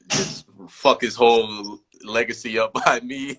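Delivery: chuckling through the words, slowed down; half-laughing joke. Real, not acted. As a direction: laugh it out as you say it. Joking about ruining his legacy.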